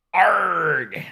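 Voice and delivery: pirate "Arg!" growl. Pretend. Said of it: "It'd be like".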